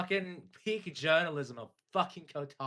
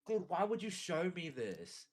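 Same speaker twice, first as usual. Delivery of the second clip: ordinary talk, nothing marked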